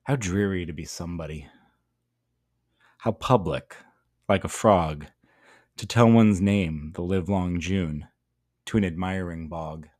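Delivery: measured, deliberate poem recital; poem reading. Shown as a demonstration. Teacher reading the poem to students. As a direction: read the poem aloud for the class.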